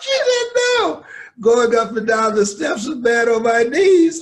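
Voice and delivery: high, loud, laughing through it; laughing retort. Retold or imitated. Mama's excuse told through loud laughter. Mother's comic reply, laughing.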